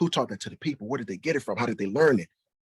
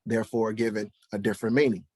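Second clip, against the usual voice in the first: usual speaking voice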